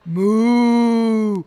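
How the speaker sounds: a huge, close cow moo; loud cow moo. A narrator's reading. The cow answers Tom with a loud moo. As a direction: bellow like a cow right at his feet. Cow moos right nearby.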